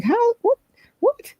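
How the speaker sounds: high, startled "What? What?"; confused squeak of disbelief; baffled what. Retold or imitated. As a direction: thrown by a stranger's mumbling, high and puzzled. Confused reaction to stranger.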